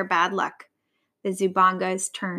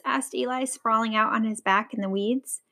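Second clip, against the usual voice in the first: plain reading voice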